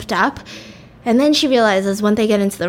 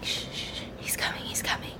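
hushed urgent whisper; 17 dB quieter, faster than usual. Retold or imitated. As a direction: whisper it, he's coming. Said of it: "And she's like".

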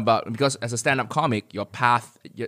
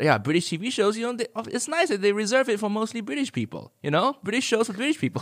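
sarcastic; 10 semitones higher than usual. Retold or imitated. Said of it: "So I said".

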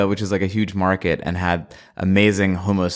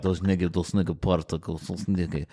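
softer, muttered mock Russian chatter; 5 semitones lower, 6 dB quieter than usual. Imitating Russian tourists muttering to each other, mock Russian accent. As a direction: lightly imitate Russian whispering between friends. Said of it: "start talking to each other like".

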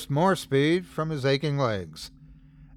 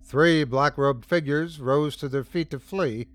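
plain reading voice

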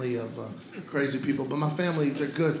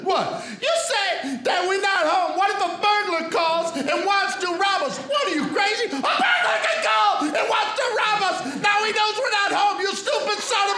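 high mock shouting argument; parents bickering; 10 semitones higher than usual. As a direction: shout him down as if he's crazy. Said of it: "Quick impression of my parents".

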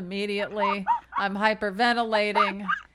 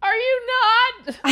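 high sobbing question; teary high-pitched imitation of a crying friend; 13 semitones higher, 6 dB louder than usual. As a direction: blubber it out through tears, imitating.

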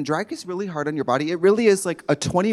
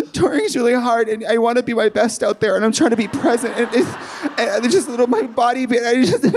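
tearful confession; 6 semitones higher than usual. Retold or imitated. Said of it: "I was like".

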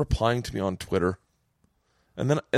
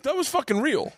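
indignant retort; 13 semitones higher than usual. Retold or imitated. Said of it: "I was like".

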